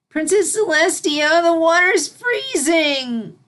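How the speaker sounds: high, loud, slow panicked cry; filly wails at her mentor from icy water. Acted. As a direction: plead out in alarm.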